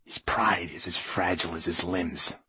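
softened, secretive aside; confidential whisper. A narrator's reading. Writer confides about glass man's pride, hushed. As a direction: let her in on it, hushed. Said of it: "he whispered confidentially to her".

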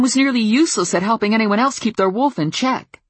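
loud, high bitter accusing; angry accusation. Acted. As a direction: hurl the blame at her.

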